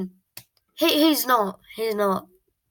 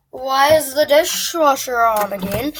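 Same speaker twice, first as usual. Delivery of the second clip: annoyed dad; 4 semitones higher, 8 dB louder than usual. Retold or imitated. Dad voiced in story.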